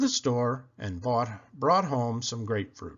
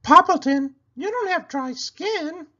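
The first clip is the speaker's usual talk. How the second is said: much higher, bright llama voice; a llama giving friendly advice; Cherry Sue voice; 8 dB louder than usual. A narrator's reading. Llama advising her friend the pig, high and sweet. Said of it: "said Cherry Sue".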